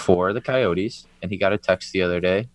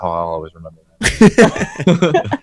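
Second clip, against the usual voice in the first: laughing; 5 dB louder, slower than usual. Retold or imitated. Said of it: "And I was like".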